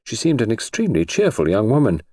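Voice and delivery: ordinary delivery, nothing marked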